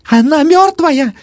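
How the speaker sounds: very high, drawn-out screech; old woman's shriek. A narrator's reading. Old woman in the corner sees death, shrieking. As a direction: shriek it out in horror, loud. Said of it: "завизжала старуха в углу".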